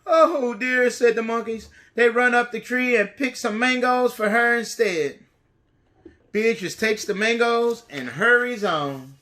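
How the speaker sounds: lively, bright storybook reading; reading to children. A narrator's reading. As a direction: read it big for little kids. Reads a picture book to children.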